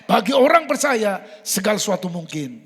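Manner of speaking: loud, ringing sermon line; preaching at full force. Acted. Preacher proclaiming to believers, loud triumph.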